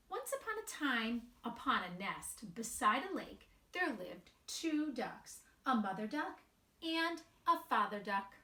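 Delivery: slow, sing-song storybook reading; gentle storybook narration with light character voices; reading to children. A narrator's reading. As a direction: read it aloud to a group of little kids. Reads picture book to children.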